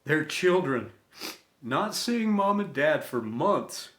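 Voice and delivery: ordinary delivery, nothing marked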